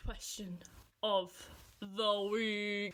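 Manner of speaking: slow dramatic game show announcer; spaced out with pauses for drama. Pretend. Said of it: "Let me try, let me try".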